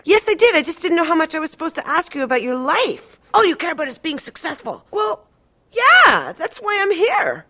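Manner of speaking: patient fighting with her therapist, high, loud, close to crying; upset shouting. Retold or imitated. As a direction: pour out the grievance, voice cracking. Quarrel with the therapist.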